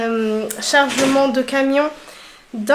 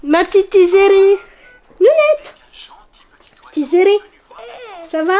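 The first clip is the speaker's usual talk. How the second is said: cooing; 5 semitones higher, 4 dB louder than usual. Real, not acted. Cooing to her little daughter.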